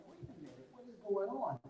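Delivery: hushed, baffled aside; mock bewilderment. Student placed in remedial class, hushed mock bewilderment. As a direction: drop the voice as if privately puzzled.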